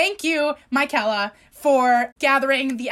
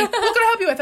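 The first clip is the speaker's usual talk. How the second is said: higher, louder mock of a clerk's greeting; imitating the men at the register, higher and louder; 9 semitones higher, 4 dB louder, faster than usual. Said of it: "these three men standing at the register".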